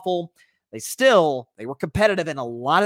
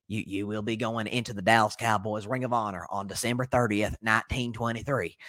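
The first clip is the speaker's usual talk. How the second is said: Jerry Jones impression; 8 semitones lower, 4 dB quieter than usual. Host imitating Jerry telling Jimmy about honor, low voice. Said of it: "I'll do my best Jerry impression".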